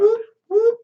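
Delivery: two loud, very high whoops. Real, not acted. Player whoops before a night of drinking, high whoops. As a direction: whoop twice like a siren.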